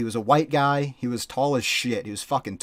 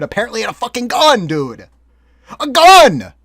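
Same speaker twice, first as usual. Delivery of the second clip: shocked shout; 9 semitones higher, 12 dB louder than usual. Real, not acted. Shocked reveal of the gun.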